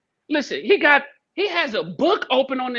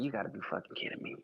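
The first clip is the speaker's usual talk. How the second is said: fast whispered exasperated aside; whispered disbelief at the kid; muttered disbelief; 11 semitones lower, 18 dB quieter, much faster than usual. Retold or imitated. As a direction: hiss it under your breath in disbelief.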